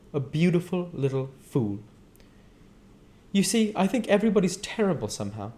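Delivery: a hint of weary cynicism; Daisy bitter. Daisy tells Nick what she said at the birth, mildly bitter.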